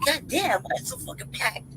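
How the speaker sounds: whispered complaint. Retold or imitated. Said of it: "I was like".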